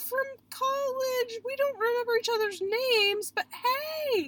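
high, slow, fake-bright voice; mock awkward greeting. Running into someone from college, fake-cheery greeting. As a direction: stretch it out like forced small talk.

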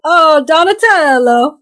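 put-on "Oh, Donatello" in Italian American accent. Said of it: "we got [name] doing accents now".

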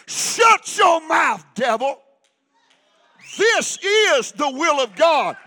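slow, loud shout at the devil; screamed rebuke. Retold or imitated. Preacher reliving his scream at the devil, slow loud shout. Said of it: "I screamed as loud as I could".

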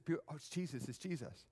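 murmured crowd rumor. Acted. Voicing the overheard crowd murmur.

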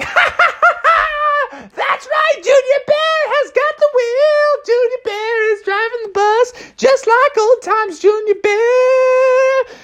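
very high, cackling cub; Junior Bear. Acted. Cub has taken the bus wheel, very high gleeful voice. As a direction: crow gleefully in a squeaky voice. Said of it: "Junior Bear was like".

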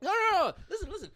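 high and eager; excited. Real, not acted. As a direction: jump in to insist. Excited to back him up.

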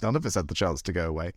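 ordinary talk, nothing marked

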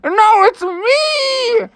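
high, very slow drawn-out plea; pleading Froggy. Acted. Froggy to dad, high slow drawn-out plea. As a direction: stretch out the plea. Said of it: "It's like".

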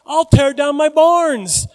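high, loud, drawn-out mock eureka; hammed-up sudden idea; rich fool's bright idea. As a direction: play a fool hatching a plan, loud and slow. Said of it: "then he said".

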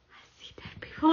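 hushed, tense whispered quote. Retold or imitated. Retelling the film's boy to her partner, in a tense whisper. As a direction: whisper it like a frightened boy in bed. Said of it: "he whispers it".

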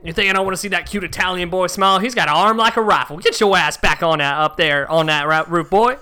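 put-on loud, rapid country-accent rant; hammed-up country accent. Homeowner sending installer back up on the roof, country accent. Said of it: "went through so many different accents".

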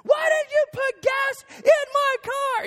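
high falsetto shriek, drawn out; hammed-up, slow screaming complaint; mock scream-crying. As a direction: imitate a hysterical wife wailing an accusation. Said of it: "scream crying".

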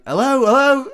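high, loud plaintive calling. Retold or imitated. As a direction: call after someone who just left you. Abandoned guy calling from stall.

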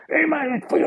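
high, put-on strong Scottish accent; Alan McGee. Club promoter ringing up the band, thick mock Scottish accent. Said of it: "Scottish accent".

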